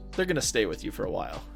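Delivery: normal talking voice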